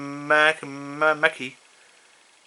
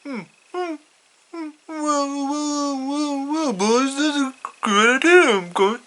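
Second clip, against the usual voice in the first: much higher put-on counselor voice, drawn-out hmms and wells; Mister Mackey voice; 11 semitones higher, slower than usual. Mister Mackey praising the boys' idea, in a much higher put-on voice. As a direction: play the school counselor musing, pitched way up. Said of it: "And Mister Mac is like".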